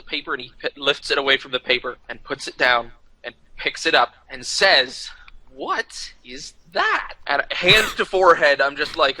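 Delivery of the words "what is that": slow, hushed, higher-pitched disbelief; slow, incredulous question. Retold or imitated. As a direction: draw out each word in soft disbelief. Retold actor baffled by picture.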